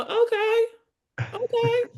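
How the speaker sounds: squeaky high okay; very high, timid agreement; eager agreeing. Retold or imitated. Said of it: "And I go".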